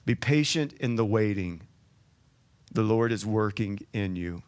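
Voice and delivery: soft, low scripture reading; calm sermon opening; quiet preaching. Acted. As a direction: ease them into the verse gently.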